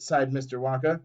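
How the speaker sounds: usual speaking voice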